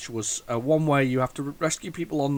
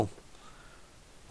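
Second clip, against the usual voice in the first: whispered aside; 23 dB quieter than usual. Real, not acted. Aside while praising the game.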